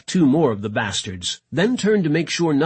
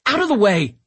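high, impatient command; snapped order; 8 semitones higher, 4 dB louder than usual. A narrator's reading. Gilmore to London, taking over the shot, snapping. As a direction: order him aside sharply. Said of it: "Gilmore snapped".

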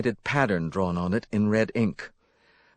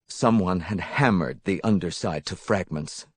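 plain reading voice

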